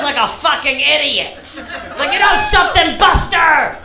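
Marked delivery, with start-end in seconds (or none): 2.0-3.8 mock dad lecture. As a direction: lecture an imagined son, hamming it up. Imagines lecturing his future son.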